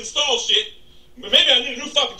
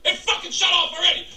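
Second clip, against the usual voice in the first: ordinary delivery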